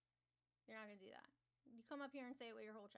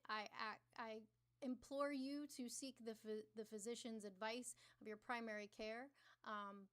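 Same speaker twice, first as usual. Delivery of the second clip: normal talking voice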